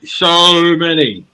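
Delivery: high, loud, very slow "so many"; drawn-out emphasis. Real, not acted. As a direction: stretch "so" right out, loudly.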